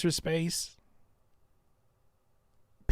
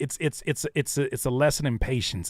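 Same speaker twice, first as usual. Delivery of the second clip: ordinary talk, nothing marked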